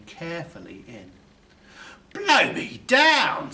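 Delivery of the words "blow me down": slow, much higher, louder exclamation; astonished burglar. A narrator's reading. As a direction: draw it out in pure amazement. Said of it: "says Burglar Bill".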